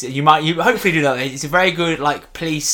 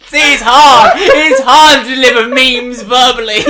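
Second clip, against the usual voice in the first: loud, high protest through real laughter; helplessly laughing, drawn-out complaint; 9 semitones higher, 11 dB louder, slower than usual. Real, not acted. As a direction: wail it out while cracking up. Protesting while cracking up at failure.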